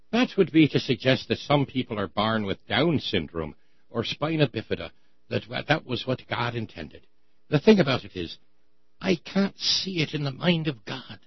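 a slipped-in mock Irish brogue, lightly done; unintended bad Irish accent. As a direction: quote the bishop in a hammy Irish lilt. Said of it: "Oh, I did the bad Irish accent!".

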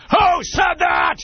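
very high, slow bellow; furious imitated shout. Khrushchev pounding the table, shouting at the room. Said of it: "he pounds his fist on the table".

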